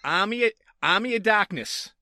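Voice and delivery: Army of Darkness in a Boston accent; Massachusetts accent. Shown as a demonstration. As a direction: drop the r in Darkness, Boston style. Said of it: "I said it first in my Massachusetts accent".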